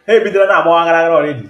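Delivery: loud, high, fast outburst; amused exclamation. Real, not acted. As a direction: call out loudly between laughs. Exclaims amid laughter at caller.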